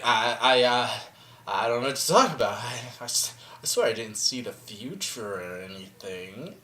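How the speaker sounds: low, very soft, hesitant mumbling; evasive stammered denial. Acted. Father denying he saw the future, sheepishly evasive. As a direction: fumble the denial quietly under your breath.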